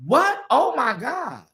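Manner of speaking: mock shocked gasp. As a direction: show the shock he should have shown. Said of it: "take a beat to stop and say".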